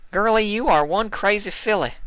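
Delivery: drawling cowpony, amused; high-pitched Applejack, teasing; Applejack's voice. A narrator's reading.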